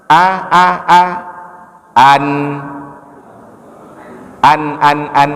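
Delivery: short "A" and "An" started without ain; clean hamza a. Shown as a demonstration. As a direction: repeat "An" with a plain open onset. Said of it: "jangan an".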